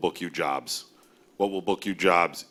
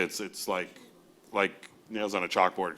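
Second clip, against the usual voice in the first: usual speaking voice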